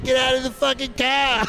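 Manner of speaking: a kid's retold Boston-accented yell at a driver; imitating a Boston yell. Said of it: "had that Boston accent".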